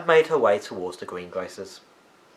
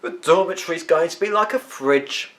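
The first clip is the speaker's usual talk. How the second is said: grimly; 6 semitones higher, 4 dB louder than usual. A narrator's reading. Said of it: "said Fidelio grimly".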